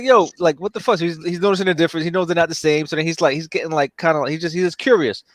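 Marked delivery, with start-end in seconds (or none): 0.0-1.0 lightly voiced alien kid, higher; a higher little voice, puzzled; mock kid voice. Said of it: "So he's like".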